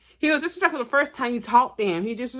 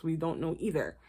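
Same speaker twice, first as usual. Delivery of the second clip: ordinary talk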